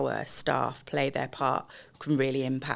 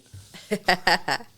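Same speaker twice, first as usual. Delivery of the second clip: short, louder, higher laugh; real laugh; 5 semitones higher, 6 dB louder than usual. Real, not acted. Guest reacting to host's happy-customers remark, amused laugh. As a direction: burst out in a brief real laugh.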